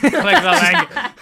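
2 slow, loud, high laughing echo; 3 stretch it out while cracking up. Acted. Repeats the plug mockingly.

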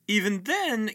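ordinary delivery

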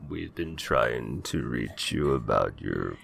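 mock robocall script, low and slow. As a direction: drone it like an automated sales call. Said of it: "Not saying, like".

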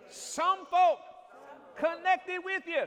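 high shouted preaching whoop, slow. Acted. Whooping climax of sermon.

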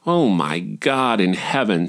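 ordinary delivery

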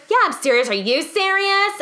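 high, mock defiant comeback; snippy retort. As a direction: throw the question back, hammed-up. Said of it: "she's like".

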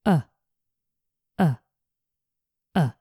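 short relaxed "Uh"; a quick weak "Uh" with mouth relaxed; schwa sound. Shown as a demonstration. Teacher modelling the schwa for learners, short and relaxed. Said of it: "Try it".

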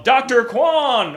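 loud drawn-out call; yelled name. Retold or imitated. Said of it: "one of us would yell".

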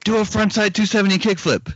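high, bossy coach voice; Lutzka coach impression. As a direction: bark orders like a skate coach. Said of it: "Here's my impression of Lutzka as a coach".

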